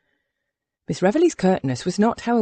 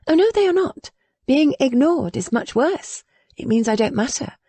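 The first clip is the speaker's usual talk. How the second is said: put-on lightly raised young lady's voice, insistent; a hint of a defiant young woman; young Willa, indignant; 7 semitones higher than usual. A narrator's reading. Willa protesting to her friend, lightly raised and insistent.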